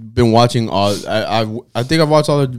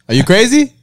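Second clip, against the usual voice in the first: mock alarmed stranger; 9 semitones higher than usual. Stranger spooked by the prankster, high and alarmed. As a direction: recoil in disbelief.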